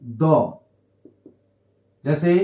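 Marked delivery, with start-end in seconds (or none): 0.0-0.6 द pronounced "duh", said alone; weak form. Shown as a demonstration. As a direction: say द with a schwa, not "dee". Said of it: "इसका sound अभी हम". (द, "अ" स्वर के साथ)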